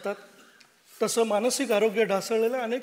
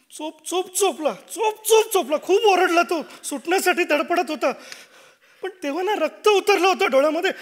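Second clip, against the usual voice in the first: rising, louder frantic retelling; agitated reliving; 5 semitones higher, 5 dB louder than usual. Acted. Father confesses the beating to his wife, building and agitated.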